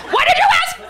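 high, exasperated retort; mock indignant. Comic snapping back at clinic staff, high mock outrage. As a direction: throw it back at them, baffled and annoyed. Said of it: "I was like".

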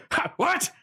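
a short laugh, then a high incredulous "what?"; startled villain. Acted. Azar caught off guard by the farewell, high startled laugh. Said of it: "Azar just goes".

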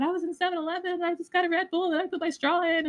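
high, sing-song send-up of her own story; mock retelling. Mocking how her sad story sounded to her ex, high sing-song. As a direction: parrot yourself like it sounds silly.

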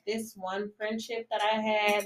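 normal talking voice

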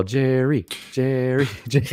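playful imitated calling; mock yell. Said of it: "Are you gonna yell".

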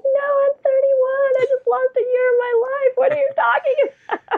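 high, wailing mock disbelief; mock outrage. To her husband, wailing high mock disbelief. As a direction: act betrayed by the lost year. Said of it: "I was like".

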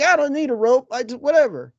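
carefree American. Retold or imitated. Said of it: "Americans are like".

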